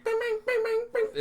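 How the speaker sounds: high sung bings; beat imitation. Shown as a demonstration. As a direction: mimic the beat's chime.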